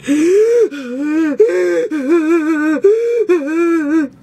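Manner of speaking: high, loud hammed-up gasping sob; mock sobbing gasp. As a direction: act out a big dramatic sob. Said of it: "I was crying big".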